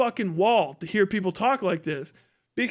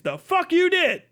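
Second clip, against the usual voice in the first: sharp high scoffing retort; incredulous angry scoff; 8 semitones higher than usual. Real, not acted. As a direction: throw it back in their face. Ranting at Democrats.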